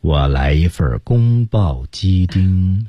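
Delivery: mock-solemn, dragged-out food order; exaggeratedly word-by-word; absurdly slow ordering. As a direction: stretch each syllable like a slow official. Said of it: "如果你点餐这么慢的话".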